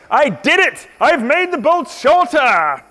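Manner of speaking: high, slow victory cry; triumphant. Real, not acted. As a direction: crow over the win. Triumph at finishing the bolt.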